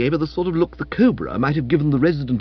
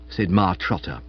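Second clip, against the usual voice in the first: usual speaking voice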